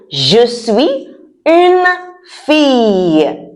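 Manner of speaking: each word held for learners to repeat; slow model pronunciation. Shown as a demonstration. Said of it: "Say that again".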